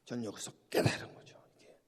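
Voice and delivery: hushed confession of a realization; whisper. Real, not acted. 깨달음을 고백하는 속삭임. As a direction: drop to an unvoiced whisper, as if sharing a secret.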